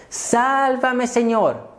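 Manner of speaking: high-pitched, drawn-out desperate plea; crying out. Retold or imitated. Preacher voicing Peter sinking into the sea, crying out. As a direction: act out Peter's cry for rescue. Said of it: "o sea, gritó".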